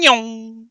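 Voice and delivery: long, high car zoom noise; drawn-out engine whine; imitated car whizz. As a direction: mimic a car zipping round the track. Imitates cars whizzing past.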